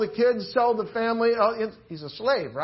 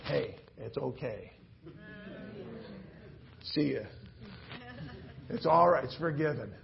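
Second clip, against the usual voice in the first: kind reassurance; 6 semitones lower, 9 dB quieter than usual. Acted. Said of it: "they go".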